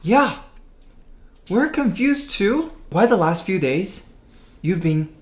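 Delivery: put-on high-pitched child voices; kids answering. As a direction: play puzzled kids, high and drawn out. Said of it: "The kids say".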